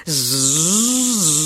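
long buzzing saw imitation; drawn-out "zzz" with a swell in pitch; sawing noise. A narrator's reading. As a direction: buzz like a saw through wood.

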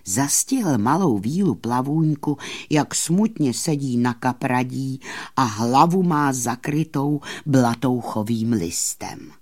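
plain reading voice

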